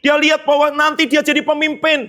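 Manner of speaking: high, driving preacher's voice; rising preaching. Acted. Preacher rallying the children about Joseph's dream, fervent. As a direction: preach it up to the congregation.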